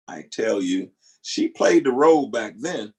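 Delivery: ordinary talk, nothing marked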